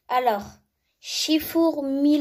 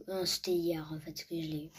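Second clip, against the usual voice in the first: whispered aside; 5 semitones lower, 6 dB quieter, faster than usual. Real, not acted. Muttered self-correction aside.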